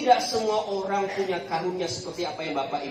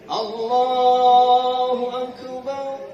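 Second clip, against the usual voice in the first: very slow, loud drawn-out takbir; unhurried takbir; 3 semitones higher, 7 dB louder, much slower than usual. Shown as a demonstration. Preacher shows elders prayer without hurrying, slow drawn-out takbir. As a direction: stretch the words out as in savoured prayer. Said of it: "Jangan buru-buru, jangan mau cepat-cepat".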